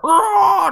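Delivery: high, loud squawking roar like a broken chicken; terrible dragon roar. Retold or imitated. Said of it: "that was a terrible dragon".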